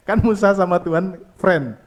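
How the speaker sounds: ordinary talk, nothing marked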